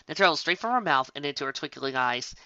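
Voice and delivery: ordinary delivery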